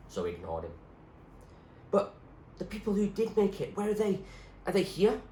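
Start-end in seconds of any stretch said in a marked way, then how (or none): 1.9-5.2 put-on higher, eager girl's voice; young girl Zoe. Zoe asking the doctor about her parents, higher anxious voice.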